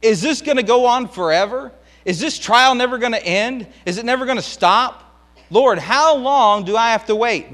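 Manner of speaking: preacher voicing David's despairing cry; desperate plea. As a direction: beg God like a man at the end of his rope. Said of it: "he even makes a statement".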